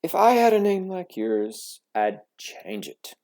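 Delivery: loud, high sneer; taunting. A narrator's reading. Classmate taunting the girl in line, sneering. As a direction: needle her with a sneer.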